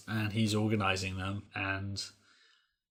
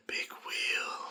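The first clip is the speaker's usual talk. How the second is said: whispered like Citizen Kane; dying whisper; 8 dB quieter than usual. Retold or imitated. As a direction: breathe out your last words. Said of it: "and I'll go".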